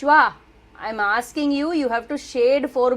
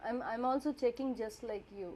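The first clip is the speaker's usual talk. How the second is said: very faint and soft; barely audible; 2 semitones lower, 13 dB quieter than usual. Real, not acted. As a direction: voice almost lost. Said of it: "your voice is, like, extremely soft".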